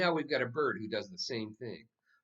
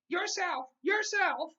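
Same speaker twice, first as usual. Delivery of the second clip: very high squawking bird voice; mynah bird; 6 dB louder, slower than usual. A narrator's reading. The mynah bird greeting everyone in French, high bird squawk. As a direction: squawk it like a parrot. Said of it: "he repeated".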